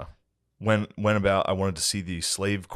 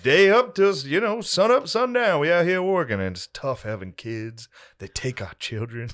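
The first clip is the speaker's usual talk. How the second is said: reenactor monologue; 7 semitones higher, 4 dB louder than usual. Retold or imitated. Reenactor lecturing the cornered visitor, high and loud. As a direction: lament plantation life in character. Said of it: "He's like".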